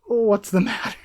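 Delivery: ordinary delivery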